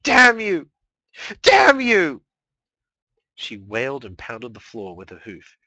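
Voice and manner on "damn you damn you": high, loud sobbing curse; wailing scream. Acted. Mare wailing curses at her sister, loud and high. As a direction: scream it through tears.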